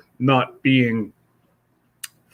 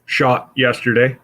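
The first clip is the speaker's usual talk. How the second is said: ordinary delivery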